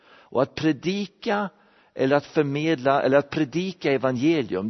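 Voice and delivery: ordinary talk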